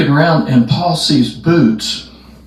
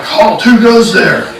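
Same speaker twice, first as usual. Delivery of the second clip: slow, high-pitched sentry call; guard's challenge; 8 semitones higher, 3 dB louder than usual. Retold or imitated. Guards through the gate challenge runners, high drawn-out call.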